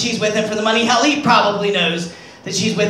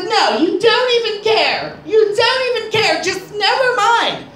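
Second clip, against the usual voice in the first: high, slow, whiny mock sob; a higher, slower put-on sobbing wife; mock crying wife; 9 semitones higher, slower than usual. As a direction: whimper it like the hurt wife, hammed-up. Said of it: "She starts crying".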